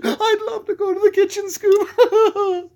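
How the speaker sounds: very high giggly Shaggy; Shaggy impression. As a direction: squeal it like a hungry slacker. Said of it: "I can do Shaggy".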